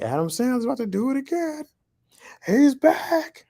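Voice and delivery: very high, mock-excited fan voice; hyped. Fans hyped for the new Sandler movie, high excited imitation. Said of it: "we were hyped to see it".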